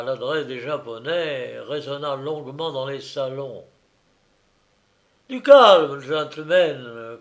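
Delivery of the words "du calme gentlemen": loud then slow and calm; calling for calm. A narrator's reading. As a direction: call out, then settle them.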